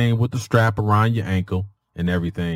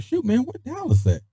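confused outburst; 12 semitones higher than usual. Retold or imitated. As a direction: ask it high and lost, half under breath. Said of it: "So I'm like".

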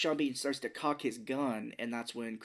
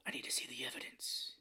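whispered gruff imitation, much softer; 10 dB quieter than usual. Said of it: "doing this gruff voice where he's like".

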